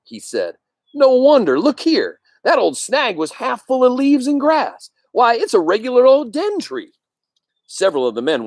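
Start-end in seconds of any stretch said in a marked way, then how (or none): 0.9-6.9 lightly voiced excited hunter, pitched up; a hunter marvelling at the den tree. Hunter shows the others the den tree, lightly voiced excited.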